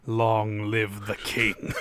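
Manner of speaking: slow, low, solemn mock granny. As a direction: intone it like a grave old lady. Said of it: "Granny's just like".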